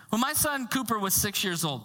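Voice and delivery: ordinary talk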